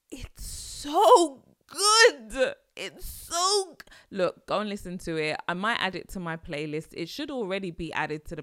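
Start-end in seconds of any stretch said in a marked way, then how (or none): 0.1-3.9 drawn-out, high, loud raving; overwhelmed gushing. Real, not acted. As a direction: stretch it out, swept away by the song. Gushing over the song, overwhelmed.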